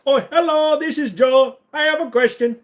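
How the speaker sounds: very high, loud mock Indian accent; Indian accent caller. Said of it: "proper Indian accent".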